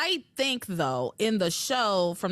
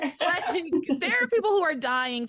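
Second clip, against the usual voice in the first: laughing; 8 semitones higher than usual. Real, not acted. Laughing rant about the show.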